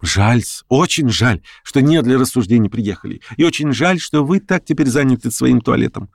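pointed regret. A narrator's reading. Lame man needles Verkhovensky.

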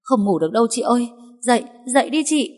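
quick, high, pressing plea. A narrator's reading. Maid rousing her mistress from sleep, urgently. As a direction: hurry the sleeper out of bed. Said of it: "giọng có vẻ gấp gáp hơn".

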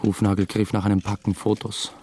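plain reading voice

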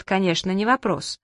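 ordinary delivery, nothing marked

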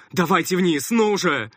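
loud urgent hurry-up; hurrying them. A narrator's reading. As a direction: rush the kids down. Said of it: "заторопил их".

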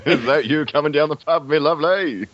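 high, gleeful Cockney; sing-out a mock pub greeting; the Hitcher's joyful voice. Friend joins in the character impression, joyful Cockney. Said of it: "he sounds so joyful".

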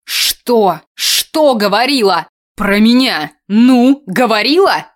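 loud, insistent grilling; angry interrogation. A narrator's reading. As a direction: fire the questions, pressing harder each time. Angry interrogation after a bark.

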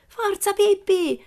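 very high, drawn-out cheer; high-pitched rooting shout; children's cheering cry. A narrator's reading. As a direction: cheer her on like two excited kids. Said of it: "gridarono Tommy e Annika".

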